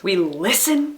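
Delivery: "listen" leaned on, left open; stress on LISTEN. Shown as a demonstration. Teacher listing all three things to do, stress on "listen". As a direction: put the weight on "listen". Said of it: "all three of those things".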